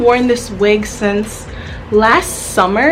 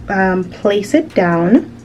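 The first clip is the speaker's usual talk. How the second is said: ordinary delivery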